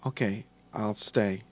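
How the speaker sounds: soft, measured giving in; measured, intoned. A narrator's reading. Carrie agreeing to stay with Nick, measured tones. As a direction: intone it evenly and quietly. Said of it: "With measured tones, she intoned".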